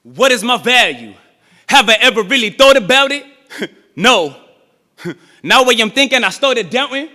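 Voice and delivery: rhythmic, rising poetry performance; spoken word with a chanted refrain; spoken word poem. Acted. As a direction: build it like a sermon in verse.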